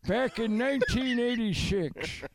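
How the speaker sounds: put-on high, slow creaky old man; old guy voice. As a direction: play a doddering old man living with mom. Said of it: "do your old guy voice".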